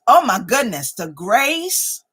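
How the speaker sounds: ordinary delivery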